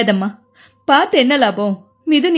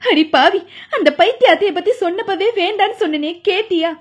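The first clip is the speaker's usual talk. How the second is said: higher, wailing scold of a grieving mother; 5 semitones higher than usual. A narrator's reading. Mother scolding her widowed daughter, wailing. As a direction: lament and scold at once, a touch tearful. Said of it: "அம்மா தலையில் அரைந்து கொண்டு அழுதாள்".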